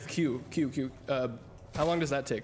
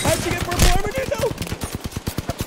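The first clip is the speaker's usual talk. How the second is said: high, loud disbelieving shout; shocked yell; 9 dB louder, slower than usual. Real, not acted. Losing a close fight.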